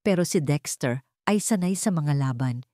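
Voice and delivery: ordinary delivery, nothing marked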